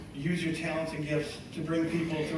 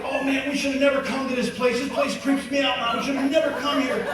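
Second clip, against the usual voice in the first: higher, pleading, spooked; scared kid whining; 6 semitones higher than usual. Retold or imitated. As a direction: beg mom to leave this creepy place. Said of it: "I'm like".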